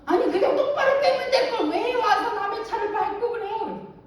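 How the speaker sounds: hammed-up angry middle-aged woman; angry scolding ajumma. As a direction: snap at her like a fierce ajumma. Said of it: "다짜고짜로".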